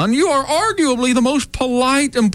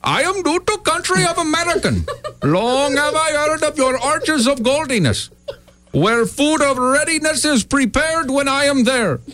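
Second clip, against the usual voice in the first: foreign visitor, broken English; 5 semitones higher than usual. Acted. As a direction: play a grand foreign traveler.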